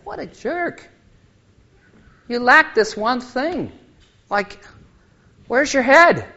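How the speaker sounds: a hammed-up put-down, jumping high on jerk and on head; playfully mock the rich man as a dismissive critic; half-joking imitation of a scornful scold; mock scornful rebuke. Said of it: "He didn't say to him".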